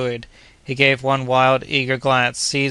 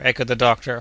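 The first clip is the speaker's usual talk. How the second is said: usual speaking voice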